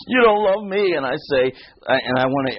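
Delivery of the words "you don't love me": lightly voiced wife, higher pitch; mock wifely reproach, pitched up; wife's complaint. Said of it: "and she's".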